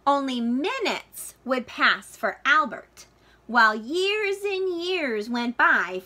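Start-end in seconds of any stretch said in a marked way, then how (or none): none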